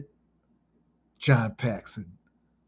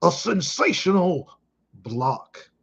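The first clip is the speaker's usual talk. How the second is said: higher, drawn-out announcer call of sensational; Marv Albert impression; 5 semitones higher, slower than usual. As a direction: ham up the sportscaster's big word. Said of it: "as Marv Albert would say".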